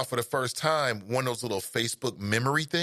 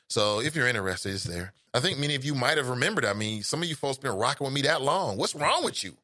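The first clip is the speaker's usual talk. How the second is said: ordinary delivery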